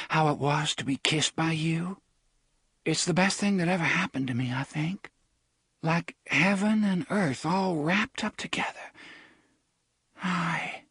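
put-on a girl saying a tender goodbye; tender young woman. A narrator's reading.

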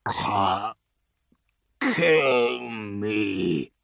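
wet gurgling plea from a broken monster; gurgling creature. Acted. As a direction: gurgle it out in agony. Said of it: "the thing gurgled from its gash-like mouth".

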